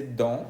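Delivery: "dent" as nasal "don", silent T; French pronunciation. Shown as a demonstration. Teacher translating diente, French nasal "dent". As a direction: end "dent" on a nasal vowel. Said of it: "que se dice".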